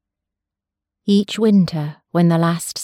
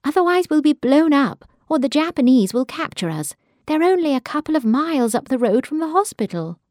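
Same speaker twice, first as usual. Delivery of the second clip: raised, higher urgent pleading; 7 semitones higher than usual. Acted. Daughter warning her mother of the Japanese advance, urgent. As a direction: press her with rising alarm.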